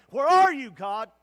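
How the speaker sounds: loud pleading call to God; anguished cry. Acted. Ishmael under the bush calling out to God, anguished. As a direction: cry it out, searching.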